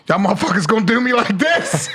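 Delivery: amused retelling of an offended outburst; mock indignation. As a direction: act affronted while laughing about it. Retells offended reaction to chatting crowd.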